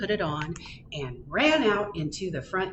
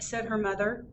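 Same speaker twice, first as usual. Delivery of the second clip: ordinary delivery, nothing marked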